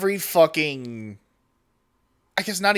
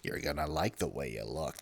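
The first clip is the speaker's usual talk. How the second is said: much deeper, much softer ad announcer voice; Men's Warehouse voice; 17 dB quieter than usual. Retold or imitated. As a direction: croon it like a seventies commercial voiceover. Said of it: "That specific, like, voice".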